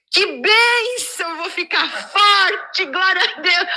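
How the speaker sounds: high, drawn-out mock rejoicing; hammed-up praise. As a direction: strain out exaggerated gratitude mid-workout. Corrects own griping with forced praise.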